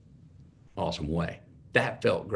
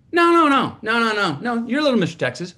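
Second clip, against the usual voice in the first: much higher and louder, mom firmly denying; insistent mom; 13 semitones higher, 7 dB louder than usual. Retold or imitated. Mom on the phone insisting he won, high and loud. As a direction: wave away his doubt like a stubborn mother. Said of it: "she goes".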